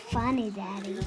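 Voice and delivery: usual speaking voice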